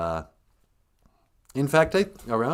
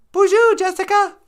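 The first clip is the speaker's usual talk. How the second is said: very high, loud cartoonish greeting; shrieked hello in a tiny sidekick voice; high-pitched excited welcome; squeaky sidekick; 12 dB louder than usual.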